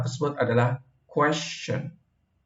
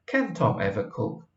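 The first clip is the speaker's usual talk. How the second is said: softer snide remark; a quiet sceptical dig, not really asking; 2 semitones lower, 6 dB quieter than usual. Shown as a demonstration. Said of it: "dia nyinyir karena ngerti bahwa Tom". (nyinyir dengan suara pelan)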